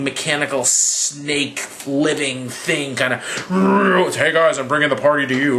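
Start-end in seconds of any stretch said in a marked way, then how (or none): 3.5-5.6 lightly voiced cocky monster; snake's cocky greeting. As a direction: play the space monster as a cocky party guest. Voicing the giant space snake.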